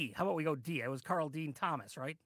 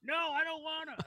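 much higher, whiny no; high whiny refusal; 12 semitones higher than usual. Acted. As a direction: whine it like a kid who won't. Playful refusal to take over.

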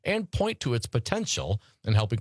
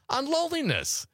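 squeaky high voice. Acted. Reading stats, playful high voice.

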